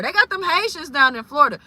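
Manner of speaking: ordinary talk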